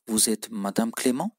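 Vous êtes Madame Clément? with the voice rising on Clément; rising question tune. Shown as a demonstration. Teacher to Turkish learners, rising question tune. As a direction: lift the end on Clément into a question. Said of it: "Vurguyla soruyor".

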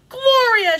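high, loud cry of the name; piercing call of warning; alarmed shout. A narrator's reading. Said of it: "shouted Frida".

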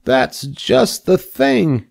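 high and very slow, savouring it; a pig delighted with his string; pleased young pig. Acted. Wilbur examining the string, high and slowly pleased.

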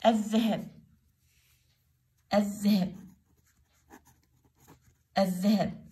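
الذهب with a doubled dh and no L; silent lam. Shown as a demonstration. Teacher repeats the word for pupils, lam silent. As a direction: say الذهب as "adh-dhahab", pausing between repeats. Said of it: "الذهب اللام ما لفظت".

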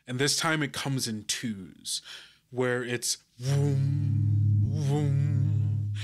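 ordinary talk, nothing marked

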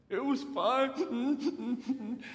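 high, drawn-out mock blubber; acted tearful breakdown. Son answering Mom after seeing his ex, acted tearful squeak. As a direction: try to hold it in and crack. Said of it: "hold it in unless I try to speak".

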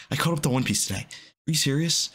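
normal talking voice

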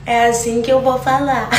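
joke voice. Pretend. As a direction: ham it up as a silly accent. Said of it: "Não, não é assim que eu vou falar".

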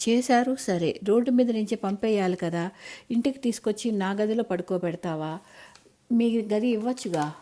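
ordinary delivery, nothing marked

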